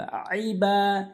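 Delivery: slow আইবা with a pressed ain. Shown as a demonstration. Teacher correcting ইবা to আইবা. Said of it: "চাপ দিয়ে বলতে হবে".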